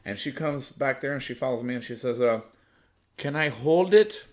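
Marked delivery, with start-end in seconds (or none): 3.2-4.2 put-on girl's voice. Girl's request voiced in story.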